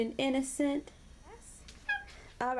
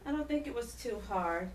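ordinary delivery

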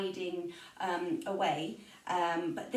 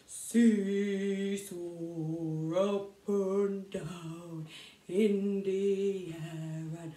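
lower, very slow singing; low slow giant; 3 semitones lower, much slower than usual. Shown as a demonstration. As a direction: stomp it out like a giant. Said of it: "really low, slow".

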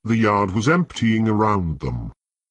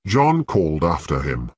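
ordinary delivery, nothing marked

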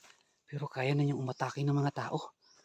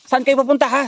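high, loud demand after them; shouting after the fleeing pair; angry shout; 12 semitones higher, 19 dB louder than usual. Real, not acted. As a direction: yell at them as they run.

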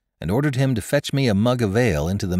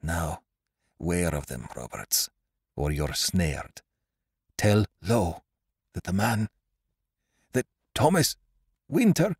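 dying words; 4 dB quieter than usual. A narrator's reading. Stabbed Russell warning Roberts with his last breath, dying. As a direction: struggle out each phrase as life fails. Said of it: "Then sudden words burst from him".

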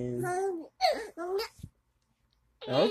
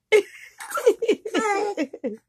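high burst of laughter; laughing; 12 semitones higher than usual. Real, not acted. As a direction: crack up laughing. Grandma laughing at baby.

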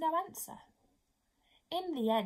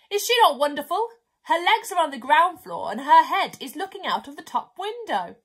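admiring pelican; 2 semitones higher, 7 dB louder than usual. Acted. Pelican boasts to the narrator about the giraffe, loud. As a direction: boast about your friend.

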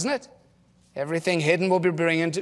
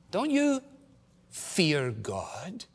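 hushed rebuke; 8 dB quieter, much slower than usual. Acted. Criminal rebukes the other.

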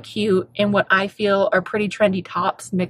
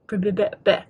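stuttering over a mouthful of spit; spitty stutter; faster than usual. Real, not acted. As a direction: trip over the b's with too much spit. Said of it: "let me get control of my spit".

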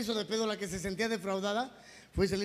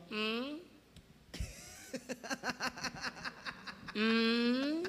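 slow, higher, drawn-out gloating; stretched-out, pointed coaxing; manipulative mother-in-law; 4 semitones higher, much slower than usual. Acted. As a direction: needle the husband with long smug hums. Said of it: "ya ves cómo son chantajistas".

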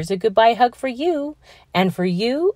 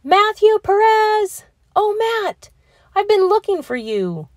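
much higher, breathless happy rush; excited relief; 5 semitones higher than usual. Acted. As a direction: spill it out, relieved to find him. Teacher finally finds Matt, relieved.

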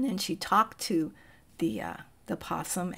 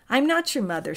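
a light robot voice, higher and louder; firm robot denial, lightly voiced; 5 semitones higher, 5 dB louder, faster than usual. A narrator's reading. Robot corrects the gosling, lightly voiced and louder. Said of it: "said the robot".

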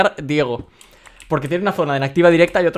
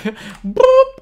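high squeaky laugh; 6 dB louder than usual. Real, not acted. Surprised by obsidian count.

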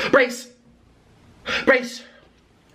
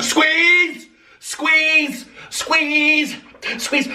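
high, loud falling shout of squeeze; 6 semitones higher, 3 dB louder, much slower than usual. Acted. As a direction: call out the squeeze order. Shouting commands in game.

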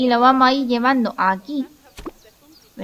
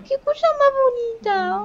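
cutesy high voice; 11 semitones higher than usual. Real, not acted. Cooing over a tiny lemur.

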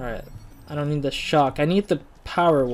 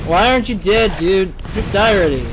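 exasperated, louder and higher; frustrated yell; 4 semitones higher, 5 dB louder than usual. Real, not acted. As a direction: yell at a tough enemy to die already. Frustrated at enemy not dying.

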